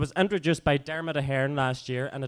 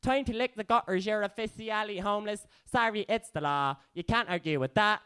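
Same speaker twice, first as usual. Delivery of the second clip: mock official; 8 semitones higher than usual. Voices bailiff inside the poem.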